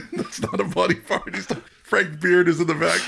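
giggling while talking; laughing. Real, not acted. Laughing while quoting the sketch.

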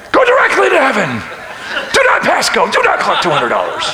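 high, loud comic holler; mock yelling. Preacher playing his parking-lot yell for laughs, loud mock holler. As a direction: bellow it like an order to passers-by. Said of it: "I just yell out".

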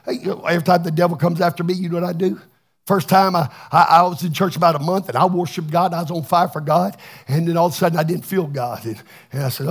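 ordinary delivery

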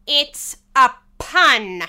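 loud, slow annoyance; indignant and heavily drawn out; angry tone. A narrator's reading. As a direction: spell it out angrily, word by word. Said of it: "the king added in an angry tone".

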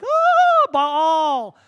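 high, loud ecstatic cry to Baal; awed crowd cry. Retold or imitated. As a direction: gasp it out like a worshipper seeing fire. Crowd cheering fire from altar.

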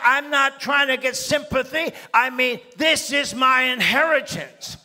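a widow storming the lawyer's office, loud and entitled; rich woman's attitude. Retold or imitated. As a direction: claim it like a rich woman who owns it all. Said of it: "with an attitude of a rich woman".

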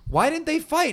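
put-on a wronged little kid demanding answers; whiny kid. A kid upset the movie ended, high whiny complaint.

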